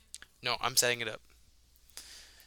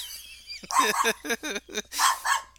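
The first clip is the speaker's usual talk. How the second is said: wheezing laughter, much higher; real laugh that runs out of voice; high breathless laugh; 11 semitones higher, 6 dB louder than usual. Real, not acted. [name] cracking up at the dog joke, breathless laugh.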